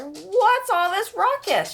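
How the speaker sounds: high, slow mock scolding mother. Mother waking to the noise, mock scolding. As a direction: act a startled mom at the mess. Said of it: "yelled his mother".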